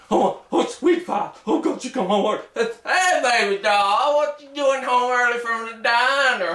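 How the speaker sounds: high, rising panicky wheedling; pleading with wife. Acted. Husband caught online begging his wife, rising to a panicked yell. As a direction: beg the angry wife not to hit him.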